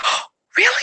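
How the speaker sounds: high, surprised aside to oneself; a gasped, pleasantly surprised reaction; taken aback. Retold or imitated. Mom privately noticing her son out-reads another kid, surprised. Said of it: "I was thinking to myself".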